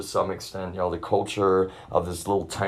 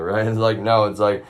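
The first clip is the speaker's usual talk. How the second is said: normal talking voice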